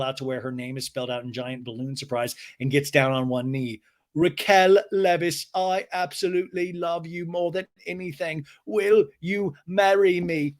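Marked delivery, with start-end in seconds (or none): 4.1-10.5 slow, high, grandly declaimed; hammed-up romantic declaration with stretched words; James's mock proposal. Recapper imitating James proposing on one knee, grandly slow.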